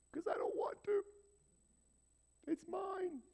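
put-on high, whispered childish refusal; a kid refusing to give up his mess; whiny toddler whisper. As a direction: hug your grudge like a toddler. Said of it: "sit in my poopy diaper".

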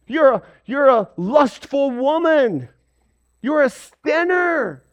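high, scornful name-calling; mock condemnation. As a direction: point the finger and brand her.

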